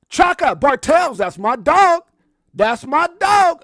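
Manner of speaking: loud, high, slow shout-out; excited hollered shout-out; hyped shout-out. Real, not acted. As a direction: holler the greeting to a friend in chat. Hyped greeting to a viewer.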